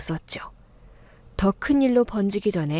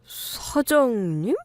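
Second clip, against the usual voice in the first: slow, drawn-out questioning; puzzled recognition; 2 semitones higher, much slower than usual. Acted. Shop worker tilts head at the customer, slowly puzzled. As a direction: stretch the word out in hesitant doubt, rising at the end. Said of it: "고개를 갸웃했어요".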